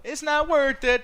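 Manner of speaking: drawn-out mock catchphrase; quoting a catchphrase. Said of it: "that thing that me and Allie always do".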